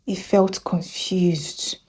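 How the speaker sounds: slow hushed narration; whisper. A narrator's reading. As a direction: whisper it slowly. Narrator telling sad moment.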